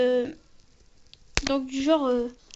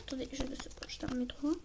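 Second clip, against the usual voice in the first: whisper; 15 dB quieter, faster than usual. Real, not acted. Whispered aside while fiddling.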